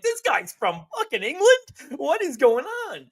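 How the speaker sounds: high-pitched mock disbelief; incredulous outburst. Listener telling friends how the actor's real accent ruined it, incredulous. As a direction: react aghast, pitch shooting way up. Retelling his shock at an accent.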